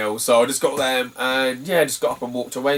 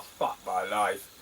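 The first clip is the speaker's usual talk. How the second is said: quiet groan; 3 semitones lower, 7 dB quieter, much slower than usual. Retold or imitated. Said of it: "I'm like".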